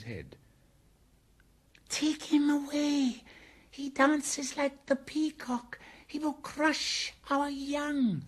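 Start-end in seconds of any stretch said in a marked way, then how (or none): 1.9-8.2 very high, slow creature voice; shrill-pitched cobras, loud and drawn out. A narrator's reading. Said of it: "said the cobras inside".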